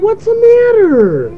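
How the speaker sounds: very high, drawn-out question; parental cooing. Real, not acted. Parent asking fussing child what's wrong, high coo.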